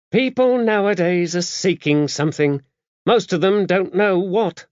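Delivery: high-voiced, brisk, no-nonsense abbess; a worldly, gruff nun laying down the rules; gruff old abbess. A narrator's reading. Dame Tabitha briefing the visiting priest, gruff and brisk.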